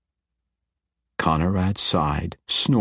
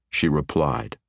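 usual speaking voice

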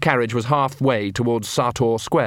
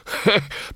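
hearty troll laugh; 9 semitones higher than usual. Acted. Troll laughs at his joke.

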